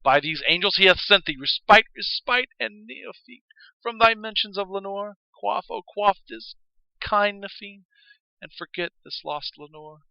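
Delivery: a loud, impassioned plea to forget Lenore; crying out. A narrator's reading. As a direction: plead with rising anguish. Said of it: "I cried".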